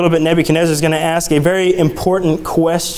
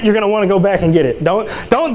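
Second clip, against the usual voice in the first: ordinary delivery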